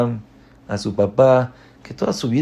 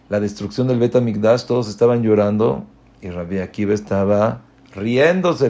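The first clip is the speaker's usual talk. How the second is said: normal talking voice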